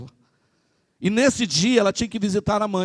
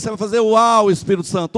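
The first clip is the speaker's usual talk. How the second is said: usual speaking voice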